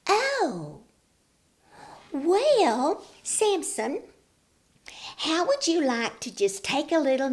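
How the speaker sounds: high, sweet scheming voice; sly sugary Delilah. A narrator's reading. Delilah luring Samson to nap, high and sugary. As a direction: sweet-talk him while plotting. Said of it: "Delilah scheming".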